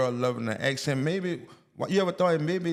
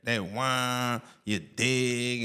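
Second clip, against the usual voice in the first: put-on New Orleans accent; 2 semitones lower, much slower than usual. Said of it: "try to stay with that shit".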